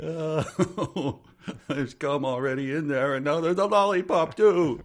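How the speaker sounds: high laughing parent voice; mock exasperated parent. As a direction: whine it like a fed-up mum.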